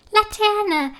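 a little sister's high, loud excited cry; excited call. A narrator's reading. Little sister cheering about the lantern parade, high and loud. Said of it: "ruft Friederike aufgeregt".

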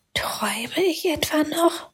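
put-on wonder-struck girl whispering; very quietly. A narrator's reading. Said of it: "sagte Emilia ganz leise". (leise geflüstertes Staunen)

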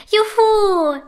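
high, drawn-out whoop of joy; jubilant cheer. A narrator's reading. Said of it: "jubeln die beiden Prinzessinnen".

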